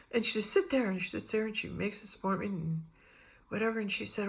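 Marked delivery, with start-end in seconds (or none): 0.5-0.9 higher, louder command; retold doctor snapping an order; curt order. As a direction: bark it like an order. Doctor orders her to wait.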